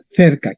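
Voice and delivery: "cerca" starting with a "th" sound; Spanish soft C. Shown as a demonstration. Teacher gives the Spanish descendant, soft-C cerca. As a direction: say "cerca" as in Castilian, th-er-ka. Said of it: "en español será cerca".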